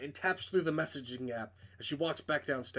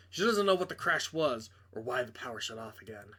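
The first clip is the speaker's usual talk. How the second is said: plain reading voice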